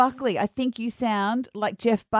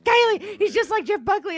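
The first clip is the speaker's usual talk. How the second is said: excited; 9 semitones higher than usual. Retold or imitated. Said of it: "and I'm like".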